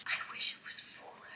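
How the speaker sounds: disappointed whisper to herself. Real, not acted. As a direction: breathe it out, let down. Quiet aside at costume reveal.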